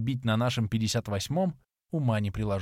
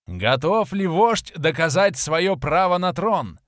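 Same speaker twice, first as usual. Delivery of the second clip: slow, loud, higher ceremonial call; herald's question; 5 semitones higher, 5 dB louder, slower than usual. A narrator's reading. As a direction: call the question across the arena. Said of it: "вопрос глашатая". (громкий торжественный вопрос глашатая)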